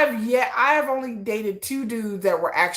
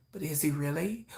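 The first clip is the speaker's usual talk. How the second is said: soft, low, doubting; doubting inner voice; 5 semitones lower, 11 dB quieter than usual. Pretend. The doubting voice in her head, soft. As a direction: murmur it like a nagging doubt.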